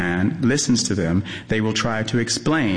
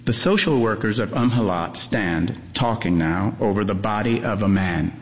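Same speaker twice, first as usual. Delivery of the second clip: ordinary delivery, nothing marked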